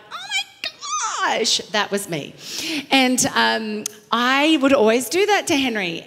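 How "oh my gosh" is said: much higher, slow squeal; hammed-up sitcom squeal; Elaine impression. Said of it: "Elaine would always hit people".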